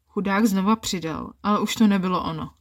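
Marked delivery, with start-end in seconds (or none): none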